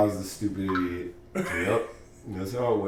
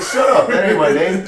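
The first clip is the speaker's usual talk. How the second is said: higher, louder mock-angry shout, laughing; retold shout; 8 semitones higher, 9 dB louder than usual. In bed, he shouts at the girl, laughing in mock anger. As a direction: bark it back at her through a laugh. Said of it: "I'm like".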